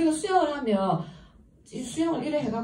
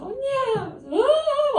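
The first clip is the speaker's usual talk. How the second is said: high, hammed-up wail of a sobbing caller; mock crying; 3 dB louder than usual. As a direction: imitate someone bawling down the phone. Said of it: "우는 거예요".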